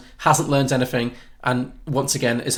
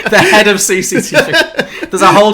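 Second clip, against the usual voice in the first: laughing; 9 semitones higher, 10 dB louder than usual. Real, not acted. Mocking the odd job title.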